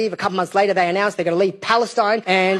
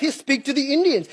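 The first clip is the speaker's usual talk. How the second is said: normal talking voice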